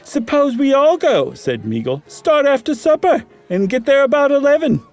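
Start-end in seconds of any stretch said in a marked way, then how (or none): 0.0-1.3 much higher, louder put-on gentleman; Meagle voice. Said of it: "I don't remember what Meagle sounded like". 2.1-4.8 much higher, louder put-on gentleman; Meagle voice. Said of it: "I don't remember what Meagle sounded like".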